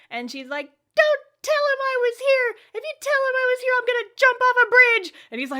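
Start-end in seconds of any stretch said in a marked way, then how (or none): 0.9-5.1 high, loud, frantic schoolgirl threat; hammed-up high girl voice; mock dramatic girl. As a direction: shriek it like a melodramatic teen.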